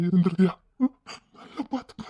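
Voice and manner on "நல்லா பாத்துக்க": whispered plea. Acted. Tearful plea to child.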